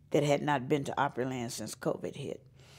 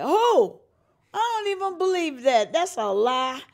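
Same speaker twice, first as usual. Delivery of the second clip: high, loud disbelieving cry; stunned disbelief; 10 semitones higher, 6 dB louder, slower than usual. Retold or imitated. The singer hearing the Opry wants her, high and loud in disbelief. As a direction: gasp it out, can't believe it.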